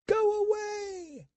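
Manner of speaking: high, drawn-out scream of terror; a girl shrieking at the pounding door; terrified scream. Acted. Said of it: "she screamed".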